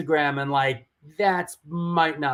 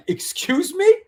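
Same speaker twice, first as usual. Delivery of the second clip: high, disbelieving excuse me; incredulous; 13 semitones higher, 4 dB louder than usual. Retold or imitated. Texted an insult, he answers in high disbelief. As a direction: act shocked by the text. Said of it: "And I'm like".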